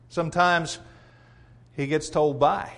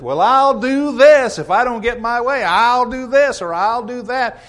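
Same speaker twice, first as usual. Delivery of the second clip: higher, louder, slow threatening whine; hammed-up threat from a pouting churchgoer; mock bully threat; 7 semitones higher, 7 dB louder than usual. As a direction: play the petty bully laying down terms. Preacher acts a bullying church member.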